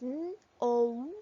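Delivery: N as a hummed "nnn", O as a long loud "oh"; letter sounds. Shown as a demonstration. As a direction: sound out "N, O" separately. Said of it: "which sounds".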